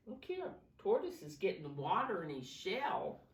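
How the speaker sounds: ordinary delivery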